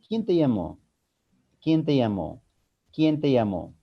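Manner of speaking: "¿Quién te llamó?" falling at the end; a wh-question, let "llamó" drop in pitch; tono descendente. Shown as a demonstration. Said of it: "Es un tono descendente". ("¿Quién te llamó?" con entonación descendente al final)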